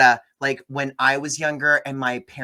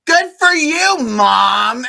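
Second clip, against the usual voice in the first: sarcastic outburst; 11 semitones higher, 10 dB louder, much slower than usual. Retold or imitated. Lena sarcastically toasting her mom.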